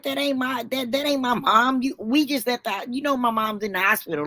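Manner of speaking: retold indignant correction; insistent denial. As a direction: argue back in disbelief. Arguing with husband, retold.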